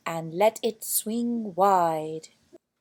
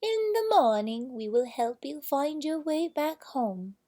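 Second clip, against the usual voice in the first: queen's voice; 6 semitones higher than usual. A narrator's reading. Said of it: "said the queen".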